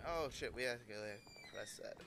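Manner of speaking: usual speaking voice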